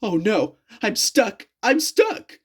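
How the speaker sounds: rising to a high panicked wail; in fright. A narrator's reading. Trapped engine panics on the turntable, rising fright. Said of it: "said James in affright".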